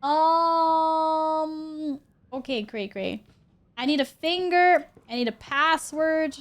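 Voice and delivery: ordinary talk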